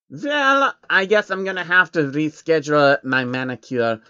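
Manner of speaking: fussy character groaning about the huge armory; Jacob voice. Acted. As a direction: act put-upon and fussy.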